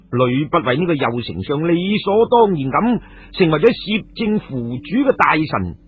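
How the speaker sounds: ordinary delivery, nothing marked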